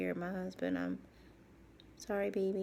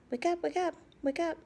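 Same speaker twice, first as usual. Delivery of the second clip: higher, louder mock wake-up call; mock nurse; 7 semitones higher, 5 dB louder than usual. Nurses rousing her after surgery, mock call. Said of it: "you know".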